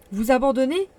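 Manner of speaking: loud, shocked disbelief; horrified protest at being sent away; shocked outcry. Acted. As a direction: cry out at the very idea. Axel refuses to abandon uncle.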